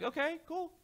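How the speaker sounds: awkward okay. Retold or imitated. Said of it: "You would be like".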